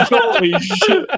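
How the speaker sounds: high laughing exclamation. Real, not acted. Friend laughing at a scary movie memory, high laugh.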